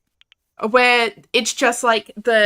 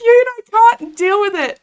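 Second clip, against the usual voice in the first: cuteness overload; 11 semitones higher, 7 dB louder than usual. Real, not acted. Overwhelmed by cuteness.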